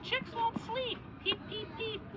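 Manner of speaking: high, soft little voice; reading to a toddler. A narrator's reading. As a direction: hush it like chicks peeping.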